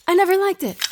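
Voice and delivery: high mock replay of her own words "I never liked it"; mocking herself. Alone after the session, mocking what she told the client. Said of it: "Why the fuck did I say that?".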